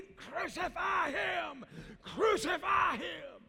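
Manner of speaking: high, slow, drawn-out shouted cry; mob shouting. Acted. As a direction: voice the crowd howling for crucifixion. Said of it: "And Pilate said".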